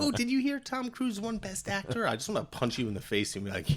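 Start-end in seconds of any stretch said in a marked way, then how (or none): 0.0-2.1 high, softer gossipy imitation; mock gushing fan. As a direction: mimic a starstruck viewer in a high voice. Mimics fans who watch on television.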